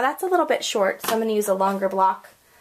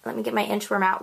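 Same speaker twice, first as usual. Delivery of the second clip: usual speaking voice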